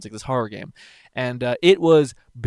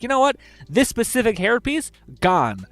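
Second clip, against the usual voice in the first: higher, mock-decisive voice for the disease; sassy alopecia; 7 semitones higher than usual. Joking about hair loss, voicing alopecia as a higher, sassy decider. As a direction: hand down the verdict on the hair. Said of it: "alopecia is just like".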